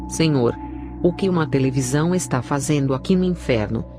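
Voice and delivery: plain reading voice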